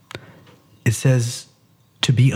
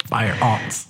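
laughing; 2 semitones lower, 4 dB quieter than usual. Real, not acted. Laughing through a pun.

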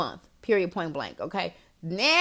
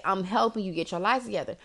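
ordinary talk

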